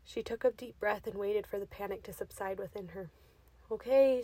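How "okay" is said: yelling. A narrator's reading. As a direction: shout up to reassure him. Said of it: "she yelled".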